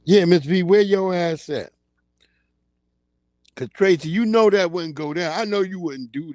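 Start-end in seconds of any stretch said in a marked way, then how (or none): none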